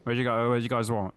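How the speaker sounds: imitating a curt, fed-up waiter; mildly irritated order-taking, acted out; retold pissed-off server. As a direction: run the repeated question together impatiently. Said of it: "pissed off taking our orders and stuff".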